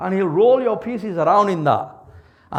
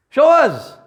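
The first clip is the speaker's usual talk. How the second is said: louder, higher demand again; demanding shout; 6 semitones higher, 6 dB louder than usual. Acted. People repeating their challenge to the prophets, loud and high. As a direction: hurl the challenge.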